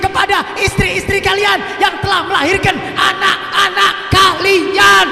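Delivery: high, loud call to the husbands; fiery preaching. Real, not acted. Preacher commanding husbands to thank their wives. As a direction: thunder it from the pulpit.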